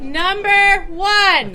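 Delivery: teacher voice. Shown as a demonstration. Leader calls the group to order in a teacher voice. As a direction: call out "Number one" like a stern teacher quieting a class. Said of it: "Don't make me use my teacher voice".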